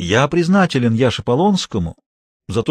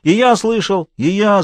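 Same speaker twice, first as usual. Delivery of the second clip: high, eager calling out, not full volume; mild raised cries of kids chiming in; shouted; 6 semitones higher, slower than usual. A narrator's reading. Kids in the hall back Sasha up, lightly shouted. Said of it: "закричали ребята в зале".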